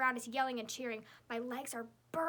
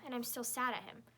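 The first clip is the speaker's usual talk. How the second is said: ordinary delivery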